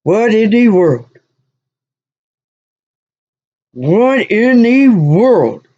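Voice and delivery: slow, drawn-out disbelief; what in the world, dragged out; bewildered. Real, not acted. Bewildered reaction.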